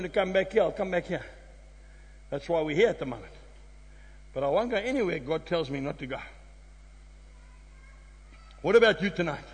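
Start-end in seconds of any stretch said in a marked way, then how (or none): none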